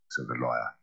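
usual speaking voice

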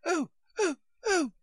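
high little shrieks of "Oh, oh, oh". A narrator's reading. Alice hit under the chin, giving little high shrieks. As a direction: squeal as the oar handle catches her chin. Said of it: "a series of little shrieks".

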